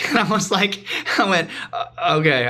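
amused, laughing as you speak; giggling. Retold or imitated. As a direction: retell it through a small giggle. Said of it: "I started to giggle".

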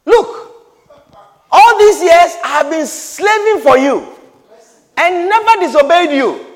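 loud, high aggrieved complaint; resentful son. Acted. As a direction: throw the grievance back at father. Elder son complaining to father.

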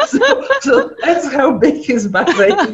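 high laugh spilling into the words. Real, not acted. Guest laughing as she tells the host the punchline. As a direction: laugh through the punchline, slowly. Punchline about circling Bahrain.

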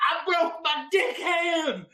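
put-on high, slow, far-off wail of a drunk woman; drunk stripper. Stripper wailing to the EMTs, high slow drunken cry. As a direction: squeal it like the injured stripper. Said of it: "She's like".

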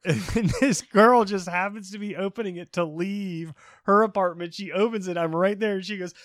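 usual speaking voice

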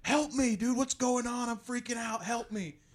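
high-pitched mock panicked yell; retold yelling. Waking Josh in drugged panic, mock yelling. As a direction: cry for help in a high, strained voice. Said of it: "and I was just yelling".

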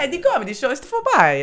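put-on singsong German with an Italian accent, high and loud. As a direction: sing it like an Italian speaking German. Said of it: "speaking German with an Italian accent".

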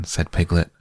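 ordinary delivery, nothing marked

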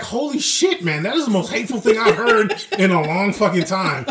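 a laughing, incredulous outburst; shocked disbelief. Retold or imitated. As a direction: react like you can't believe what you just heard. Shocked reply to hateful remark.

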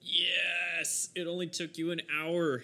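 high, loud teasing cheer; loud, high jubilant ribbing; mock triumph. Real, not acted. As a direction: cheer with exaggerated relief. Game master jeers at player's spell.

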